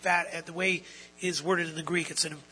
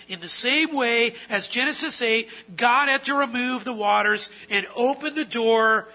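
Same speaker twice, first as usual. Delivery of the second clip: rising, louder preacher's proclamation; full-force preaching; 5 semitones higher, 8 dB louder than usual. Acted. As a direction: preach it out, driving each phrase home.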